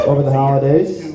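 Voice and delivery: ordinary talk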